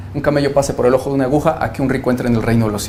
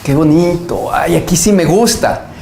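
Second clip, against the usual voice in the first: mock-delighted churchgoer, higher and drawn out; imitando embeleso; 4 semitones higher, 4 dB louder, slower than usual. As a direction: gush slowly in a higher put-on voice. Imita al feligrés encantado. (embelesado fingido, voz aguda)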